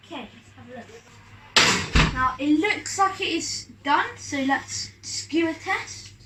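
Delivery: ordinary talk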